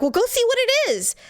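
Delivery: high, pushy command to go look; urgent order. Retold or imitated. Wife ordering husband to check the noise, high and insistent. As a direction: send him out the door now.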